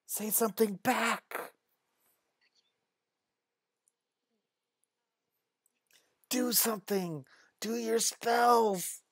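put-on high-pitched pleading kid voice; urging girls. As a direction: egg your friend on in a high voice. Girls urge Nelyani to act.

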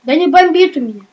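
raging gamer. Real, not acted. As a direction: snap back loud and fast, punching бомбит. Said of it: "А видно, что бомбит".

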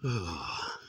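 ordinary delivery

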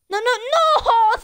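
high, loud string of refusals; loud protest. Real, not acted. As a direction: cry out in alarm at a blunder. Sees a teammate's blunder.

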